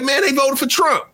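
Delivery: high, lightly voiced confidential tip-off; imitating the worker. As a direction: let the news slip in his voice. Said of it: "He like".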